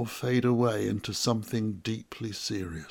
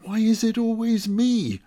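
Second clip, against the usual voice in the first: exasperated; 7 semitones higher, 5 dB louder than usual. Acted. Exasperated inner complaint.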